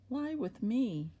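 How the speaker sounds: high, slow, drawn-out invitation; master's wife coaxing. Acted. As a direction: lift the pitch and stretch out the temptation. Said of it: "his master's wife cast her eyes".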